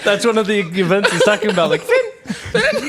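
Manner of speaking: cracking up at the joke; stretched, giggling delivery; laughing. Real, not acted. Joking about the event name.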